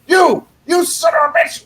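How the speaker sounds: high, sputtering mock-angry shout; hammed-up raging tirade; imitated cussing fit. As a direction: mimic an old man cussing his head off. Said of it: "just cussing his freaking head off".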